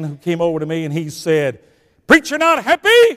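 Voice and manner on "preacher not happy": high, booming mock challenge; loud acted question. African man questioning the preacher, loud acted challenge.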